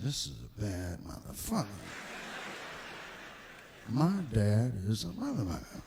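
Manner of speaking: low, impressed mutter about dad; awed low mutter. Retold or imitated. Comic awed son marvels at his father, low. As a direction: voice yourself as the kid, low and in awe.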